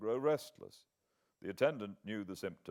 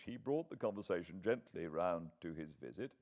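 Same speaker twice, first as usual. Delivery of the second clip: ordinary delivery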